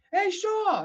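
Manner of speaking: high, loud greeting across the hall; calling out. Retold or imitated. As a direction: shout his name over the crowd.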